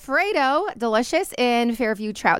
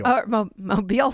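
light Alabama accent on mobile; 3 semitones lower than usual. Shown as a demonstration. Wife mimicking an Alabama accent on mobile. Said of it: "I said it with an accent like".

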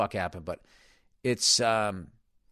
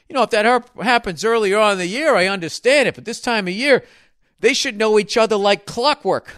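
loud, higher-pitched complaining spectator; indignant sports fan; 6 semitones higher, 7 dB louder than usual. Retold or imitated. Guy behind griping about a sloppy pass, indignant. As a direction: gripe loudly like the guy behind you.

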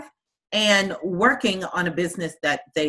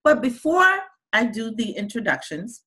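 ordinary talk, nothing marked

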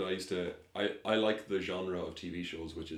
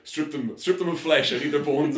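higher, louder mock insistence; hammed-up demands; 7 semitones higher, 10 dB louder than usual. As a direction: order them about, exaggerated for comedy. Acting bone expert's demands.

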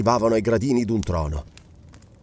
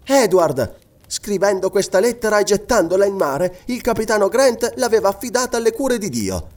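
steady, earnest, higher-pitched appeal; firm voice; 8 semitones higher than usual. A narrator's reading. Tearful wife stepping up to her husband, firm-voiced. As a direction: plead with conviction, keeping the voice firm. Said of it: "ma con voce ferma".

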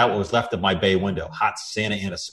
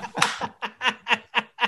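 high helpless laugh; 4 dB quieter than usual. Real, not acted. Breaks up mid-line.

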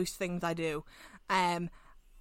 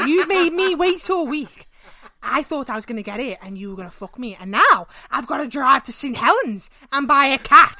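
mock complaint; 3 semitones higher, 8 dB louder than usual. Girlfriend complaining about waiting.

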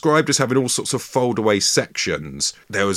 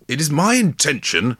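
Victorian man voice; 7 semitones higher, 3 dB louder than usual. Acted. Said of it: "I need to workshop a voice here".

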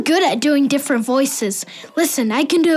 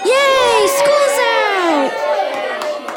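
excited cheer; 8 semitones higher, much slower than usual. Acted. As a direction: whoop it up like the final bell just rang. Kids cheer as school ends.

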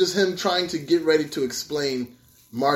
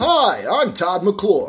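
slow, drawn-out TV pitchman intro; infomercial voice; much slower than usual. Pretend. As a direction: introduce yourself like a late-night TV host. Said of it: "Yeah, there we go".

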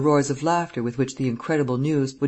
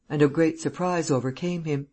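ordinary delivery

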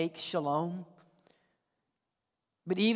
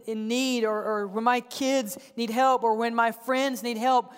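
usual speaking voice

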